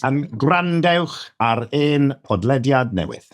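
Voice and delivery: careful slow Welsh demo; slow teaching read. Shown as a demonstration. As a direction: slowly spell out grandewch ar ein podlediad newydd. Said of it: "teaching the full Welsh phrase".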